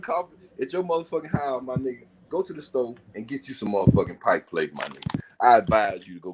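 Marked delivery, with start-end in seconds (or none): none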